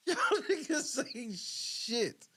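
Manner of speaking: words stretched out through laughter; laughing. Real, not acted. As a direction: laugh while you say it, slowly. Laughing reaction to a clip.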